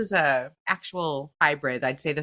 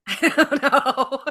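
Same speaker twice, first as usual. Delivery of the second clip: loud, high laughing I don't know; words squeezed out through a laugh; real laughter; 8 semitones higher, 4 dB louder, much slower than usual. Real, not acted. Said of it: "laughing at confusion".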